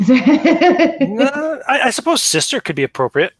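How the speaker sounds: high, loud laughing talk. Real, not acted. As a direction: talk through a burst of laughter. Laughing at the joke.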